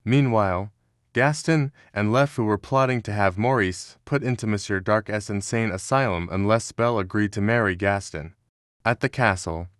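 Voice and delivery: ordinary delivery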